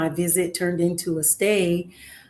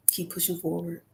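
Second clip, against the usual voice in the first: soft echo; 9 dB quieter than usual. Real, not acted. Advising aspiring artists, softly fading repeat. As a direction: repeat it much softer, fading away. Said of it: "repeated advice to listeners".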